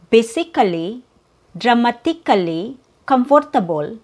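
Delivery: "basically", "dramatically", "comfortable" with every syllable sounded; unreduced vowels in "basically", "dramatically", "comfortable"; "dramatically" with hard T, "comfortable" with full "or"; different pronunciation. Shown as a demonstration. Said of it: "a different type of pronunciation".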